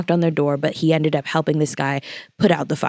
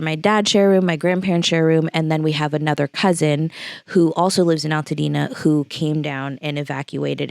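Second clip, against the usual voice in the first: normal talking voice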